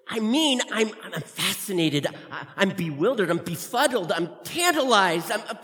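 loud, building show-off. Acted. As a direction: proudly pile on big words. Showing off big words.

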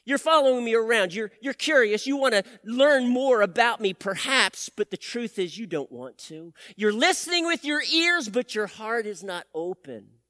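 urgent pleading sermon voice; impassioned preaching. Acted. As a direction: confront the half-hearted followers. Voicing Jesus to followers.

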